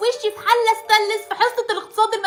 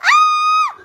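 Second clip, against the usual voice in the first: a sudden scream far higher and louder; shocked scream; 4 dB louder, much slower than usual. Acted. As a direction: scream as mother vanishes before her eyes. Mother vanishes, she screams. (صرخة مفزوعة عالية)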